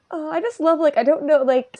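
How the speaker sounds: ordinary talk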